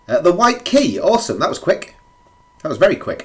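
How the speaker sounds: high and loud with delight; thrilled at the lucky find; excited. Real, not acted. Finding the key quickly.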